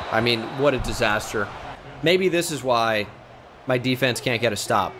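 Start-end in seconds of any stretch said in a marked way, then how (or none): none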